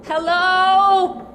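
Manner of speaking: loud high call for the guard. Acted. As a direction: shout to someone outside. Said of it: "Why are you yelling like that?".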